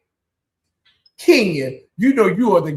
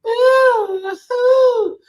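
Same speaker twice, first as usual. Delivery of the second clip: mock diva ooh. Mimicking Diana bugging her eyes.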